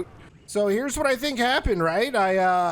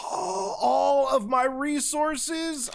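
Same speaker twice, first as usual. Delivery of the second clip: gasp of dismay; 5 semitones higher, much slower than usual. Real, not acted. Discovers resources gone.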